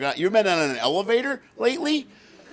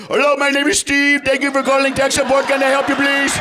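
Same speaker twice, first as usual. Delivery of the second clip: tech support agent; 7 semitones higher, 4 dB louder than usual. Retold or imitated.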